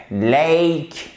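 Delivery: "lake" drawn out with a clear ay glide; careful model "lake", long diphthong. Shown as a demonstration. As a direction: hold the vowel of "lake". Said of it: "vous avez la même diphtongue".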